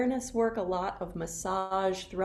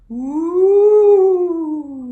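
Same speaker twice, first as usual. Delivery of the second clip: high, loud spooky ooh; ghost voice; 9 semitones higher, 17 dB louder than usual. Shown as a demonstration. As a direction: wail like a ghost. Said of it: "And a sound like a ghost".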